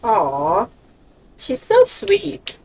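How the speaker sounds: slow high-pitched aww; cooing. Real, not acted. Cooing over the girl.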